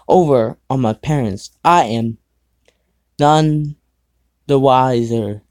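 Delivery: ordinary talk, nothing marked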